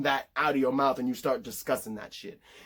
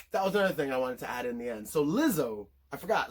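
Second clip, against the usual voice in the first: ordinary delivery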